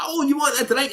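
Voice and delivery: high, sweet offer of a drink; barmaid offer. Retold or imitated. Barmaid offering him a drink, high and sweet. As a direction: pitch it up like the barmaid. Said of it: "she's like".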